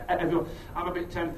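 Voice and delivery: ordinary talk, nothing marked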